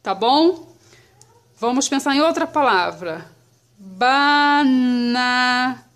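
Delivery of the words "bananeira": "Bananeira" in long, sustained syllables; drawn-out syllables. Shown as a demonstration. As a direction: stretch "Bananeira" slowly, holding each syllable. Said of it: "Vamos separar a palavrinha".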